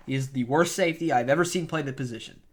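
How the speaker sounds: normal talking voice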